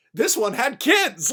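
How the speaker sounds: drawn-out goading cry; acted taunt. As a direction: hurl the guilt at the killer. War god taunts killer.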